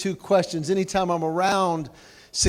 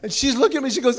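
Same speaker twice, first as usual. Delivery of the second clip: usual speaking voice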